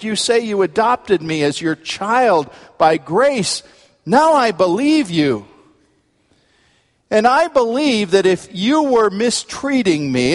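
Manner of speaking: high, forceful plea to God; impassioned preaching. Acted. Preacher modelling a daily prayer to the Lord, impassioned preaching.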